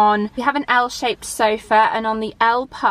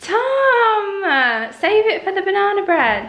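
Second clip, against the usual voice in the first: outraged shout; 9 semitones higher, slower than usual. Real, not acted. Partner eating the syrup.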